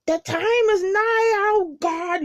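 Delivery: high, drawn-out little prophet voice. Retold or imitated. Reviewer mimics the show's little voice, high-pitched drawn-out proclaiming. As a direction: cry it out high and slow. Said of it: "you hear this little voice".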